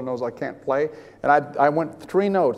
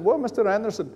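a touch higher, casual doctor imitation; matter-of-fact doctor; 6 semitones higher than usual. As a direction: imitate a doctor breaking news matter-of-factly. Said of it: "matter-of-factly".

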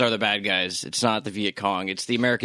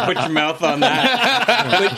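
slow, laughing, higher Dafoe impression; Willem Dafoe; 7 semitones higher, 4 dB louder, much slower than usual. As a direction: drawl it out through a laugh. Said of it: "He's like".

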